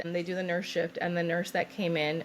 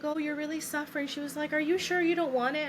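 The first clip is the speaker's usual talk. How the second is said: high, cooing mock sympathy; imitated concerned nurse; 9 semitones higher than usual. Nurse checks on suffering patient, high imitated concern. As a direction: pitch it well up, a nurse fussing gently.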